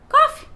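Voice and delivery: "coffee" shot up high and loud, mocking the American way; exasperated coffee. Shown as a demonstration. Venting at Americans, fed-up mock "coffee". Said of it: "E isso me irrita".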